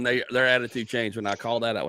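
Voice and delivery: ordinary delivery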